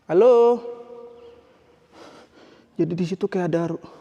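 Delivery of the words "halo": loud high hello into the empty hall; calling out. Real, not acted. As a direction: call out to anyone who might be there.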